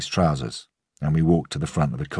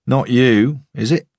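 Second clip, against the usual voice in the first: higher, louder, grinning teasing voice; cheery photographer; 8 semitones higher, 6 dB louder, slower than usual. Acted. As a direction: tease him with a grin.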